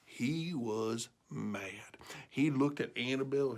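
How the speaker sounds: ominous. A narrator's reading. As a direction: drop it down to dread. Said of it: "narrating anger".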